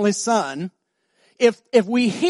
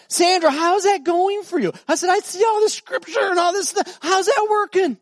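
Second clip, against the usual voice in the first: pointed; 10 semitones higher than usual. Retold or imitated.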